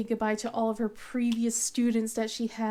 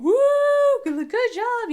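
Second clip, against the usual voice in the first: high, louder mock cheer; hollering; 13 semitones higher, 9 dB louder than usual. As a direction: imitate a husband whooping from the audience. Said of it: "you know, hollering".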